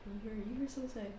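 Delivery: normal talking voice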